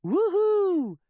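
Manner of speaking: high, loud whooping cheer; crew cheering. Acted. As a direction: whoop it out with joy. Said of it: "cries the crew".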